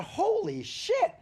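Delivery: very high, loud, drawn-out exclamation; amazed sculptor. Retold or imitated. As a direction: stretch it out, soaring in astonishment.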